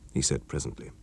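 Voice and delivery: ordinary delivery, nothing marked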